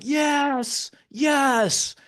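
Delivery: high, loud, drawn-out victory yell; triumphant cheer. Retold or imitated. Husband celebrates getting her on the toilet, triumphant cheer. Celebrating success in retold story.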